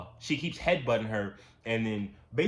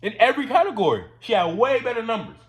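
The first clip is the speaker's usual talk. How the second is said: much higher and louder, fast and indignant; outraged outburst; 12 semitones higher, 10 dB louder than usual. Real, not acted. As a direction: blurt it out in disbelief at the unfair result. Repeating scorecard point in outrage.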